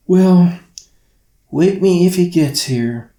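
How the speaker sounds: put-on drowsy, slurring young girl; half asleep, words slurring together; sleepy slur. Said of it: "Carly's words slurred with drowsiness".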